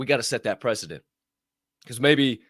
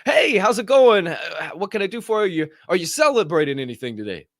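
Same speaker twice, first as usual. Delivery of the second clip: cheery hostess; 8 semitones higher, 5 dB louder than usual. Retold or imitated. Smiling hostess greeting the family, bubbly. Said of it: "the one hostess that's smiling says".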